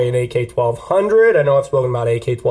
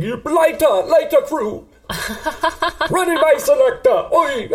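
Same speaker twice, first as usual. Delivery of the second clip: high, hammed-up fake Jamaican accent; mock Jamaican MC hype voice; 11 semitones higher than usual. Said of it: "rock this fake Jamaican accent".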